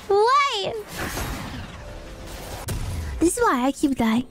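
ordinary talk, nothing marked

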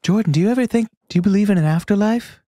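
lightly voiced [name], dreamy; mock MJ monologue. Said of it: "doing weird M J monologues".

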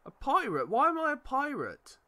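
high, loud puzzled outrage; indignant surprise. Real, not acted. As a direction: protest in disbelief.

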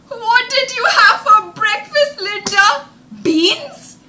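high, loud taunting call; teasing boy. Retold or imitated. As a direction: tease her across the class. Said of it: "Mike called out to her".

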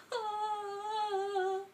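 high, soft mock crying; whimpering sob-laugh. Real, not acted. Streamer after falling for a joke, high whimpering. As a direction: whimper in comic defeat.

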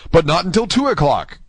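calm but high-pitched reply. A narrator's reading. D'Artagnan postponing Aramis's duel, calm. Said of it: "with the same calmness".

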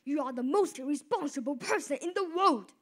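loud angry scolding; furious father yelling at son. Acted. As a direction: tear into the boy loudly.

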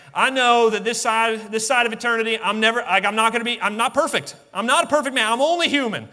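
lightly acted high-pitched excuse; giving-up churchgoer. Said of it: "many in the church throw up their hands and say".

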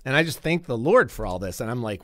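ordinary talk, nothing marked

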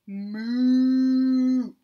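lightly voiced cow moo; a gentle mooing cow; a hint of a cow moo. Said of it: "Cow says".

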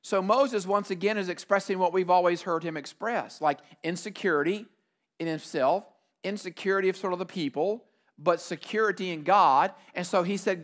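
ordinary delivery

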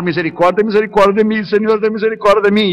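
chanting, repeated mock supplication; imitated plea for mercy. Frightened passenger crying out to God for mercy, lightly imitated pleading. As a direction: lightly mimic a desperate man praying. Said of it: "empezó a clamar".

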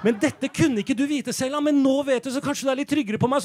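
normal talking voice

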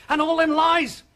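angry. Acted. Husband rails at lies.

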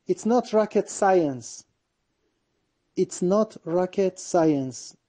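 very slow, stress on not; slow model reading. Shown as a demonstration. Teacher slowly modelling the idiom for students. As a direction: lean on not, word by word. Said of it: "teacher models phrase slowly".

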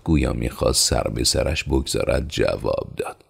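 ordinary delivery

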